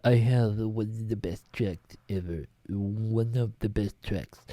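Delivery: shaky-attempt presidential impression, unhurried; Donald Trump. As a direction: draw it out like the politician bragging. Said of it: "I can't do his impression".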